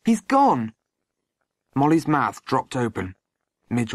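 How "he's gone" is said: put-on high-pitched boyish boldness; emboldened announcement. A narrator's reading. Boy breaking the news to Molly, emboldened. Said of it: "as his courage returned".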